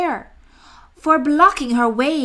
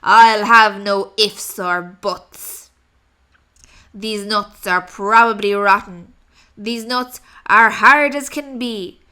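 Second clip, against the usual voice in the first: lower, loud snarling robber; snarling rat; 4 semitones lower, 7 dB louder than usual. Acted. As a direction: growl it out greedily. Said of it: "snatched the sack and snarled".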